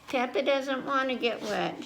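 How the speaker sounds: ordinary delivery, nothing marked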